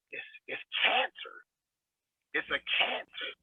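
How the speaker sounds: whispered, heavy and grave. Real, not acted. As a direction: drop to a whisper to land the point. Grave point about victim mentality.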